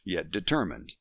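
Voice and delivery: plain reading voice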